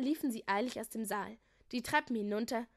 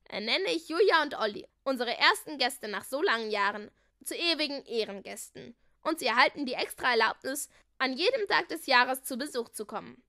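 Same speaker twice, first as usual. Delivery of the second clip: loud, emphatic announcement; royal proclamation; 2 semitones higher, 8 dB louder than usual. A narrator's reading. Prince naming guests of honour, loud proclamation. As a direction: declare it ceremonially to the court.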